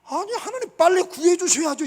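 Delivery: high, loud urgent protest; impatient plea. Acted. Preacher voicing Jeremiah begging God for rescue.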